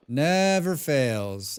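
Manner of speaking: very slow, stretched delivery; long, emphatic drawl; drawn-out emphasis. Real, not acted. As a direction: stretch out each word.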